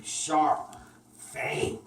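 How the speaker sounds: usual speaking voice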